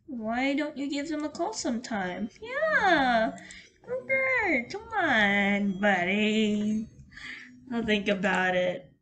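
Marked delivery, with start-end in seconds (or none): 2.4-6.9 cheering on. Real, not acted. Streamer urging Kruger to call his friends, excited. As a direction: egg the character on.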